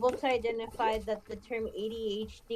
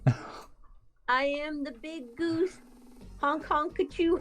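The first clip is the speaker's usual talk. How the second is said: silly clown; 4 semitones higher, 3 dB louder than usual. Pretend. Goofy goose honk performance.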